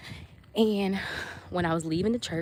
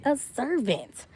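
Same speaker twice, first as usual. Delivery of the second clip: "servant" stretched slow and higher; emphatic repetition; 6 semitones higher, slower than usual. Retold or imitated. Pleading her case about leaving church, slow stress on servant. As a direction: draw out SERVANT with a pitch swoop.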